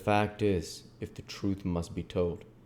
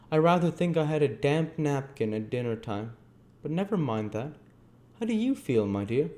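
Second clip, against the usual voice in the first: put-on higher-pitched, fatherly old gentleman voice; kindly old gentleman; 8 semitones higher, 4 dB louder than usual. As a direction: brush off the complaint, then ask kindly after the boy.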